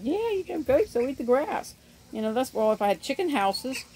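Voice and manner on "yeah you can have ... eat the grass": friend's voice pitched up high; high mock friend voice. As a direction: squeak out the friend's breezy advice. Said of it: "Mine said".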